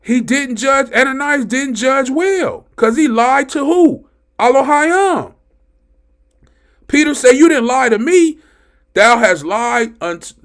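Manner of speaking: very high, loud pulpit shouting; amped-up preaching. Real, not acted. Preacher tells how Ananias lied to Elohim, amped-up shouting. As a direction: preach it at the top of your voice. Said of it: "I get so amped up about the word".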